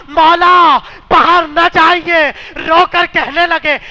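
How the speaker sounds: loud, rising preaching that wails the story; impassioned majlis lament. Acted. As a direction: drive the mourners to tears with full-force lament.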